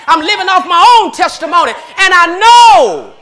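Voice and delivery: loud, high, shouting preacher; preaching at full force. Acted. Preacher testifying to the congregation, loud and high.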